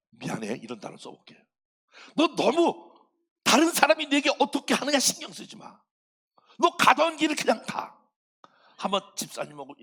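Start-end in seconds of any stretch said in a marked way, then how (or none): 2.1-8.0 높고 큰 소리로 다그치듯; 힘주어 밀어붙이는 설교; urging. Real, not acted. Preacher urging congregation. (성도들에게 소리 높여 당부하며)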